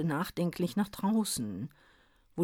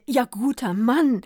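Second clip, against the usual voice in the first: excited stammering; 6 semitones higher, 6 dB louder than usual. Acted. Druggist stammers at the soaked Santa, excited and loud. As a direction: fall over your words in shocked pity. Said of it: "stammelte er".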